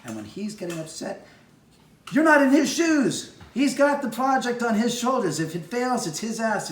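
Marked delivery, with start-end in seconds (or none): 2.1-6.7 high, loud and forceful; emphatic. Real, not acted. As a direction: drive the point home hard. Defending the director passionately.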